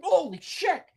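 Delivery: high-pitched shocked exclamation; startled cry. Acted. Racer hears something wet hit the wall, high startled cry.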